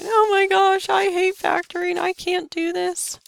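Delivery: high, whining complaint of a frustrated student; whiny crying student. Pretend. Math student giving up on factoring, high mock whine. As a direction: whine it like a kid giving up on homework. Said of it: "we start crying and whining and going".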